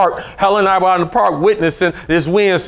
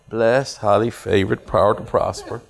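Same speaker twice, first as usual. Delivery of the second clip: timid reply; 8 semitones lower, 5 dB quieter than usual. Retold or imitated.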